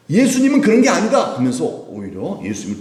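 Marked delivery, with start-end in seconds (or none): 0.1-1.4 much higher, louder defending cry; rebuking cry. Acted. Repentant thief rebuking the other, loud cry. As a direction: shout the defense of Jesus.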